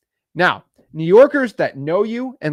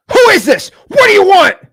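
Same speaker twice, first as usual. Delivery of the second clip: much higher and louder, hostile demand; aggressive New Yorker; 13 dB louder than usual. Retold or imitated. New York seller answering the investor's call, shouting much higher and louder. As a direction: bark it like an irritated Bronx seller. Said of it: "aggressive like that".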